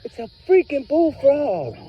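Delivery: high, drawn-out exclamation; exasperated outburst. Real, not acted. Owner to dog about a bullfrog, exasperated. Reacting to the dog over frog.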